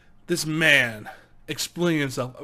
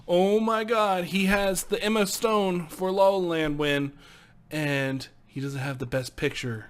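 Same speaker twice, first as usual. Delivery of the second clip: ordinary talk, nothing marked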